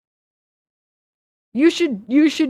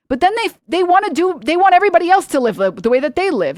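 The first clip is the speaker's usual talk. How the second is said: heated rant; 9 semitones higher, 5 dB louder than usual. Real, not acted. Rant about right-wingers.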